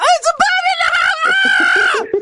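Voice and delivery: high shrill mock scream; boys screaming. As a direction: squeal like panicking kids. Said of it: "the boys are screaming".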